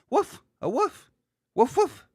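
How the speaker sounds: high-pitched toddler imitation; little kid. Imitating kids pointing at a dog, little-kid voice. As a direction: say it like a small child naming a dog. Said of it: "little kids, they're pointing a dog".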